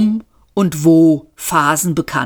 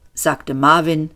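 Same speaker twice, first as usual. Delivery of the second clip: usual speaking voice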